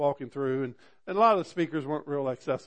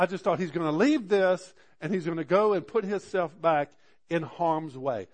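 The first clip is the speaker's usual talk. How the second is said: louder, rising sermon build; preaching with fervor; 3 semitones higher, 6 dB louder than usual. Acted. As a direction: drive it home like a preacher at full force.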